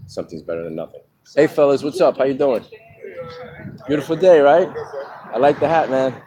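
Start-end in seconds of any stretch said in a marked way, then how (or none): 1.3-6.2 higher and louder, overly cheery hello to strangers; forced nice greeting. Pretend. Greeting guys in ugly hats, fake friendly cheer. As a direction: greet them with put-on friendliness you don't feel. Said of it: "But you gotta be nice".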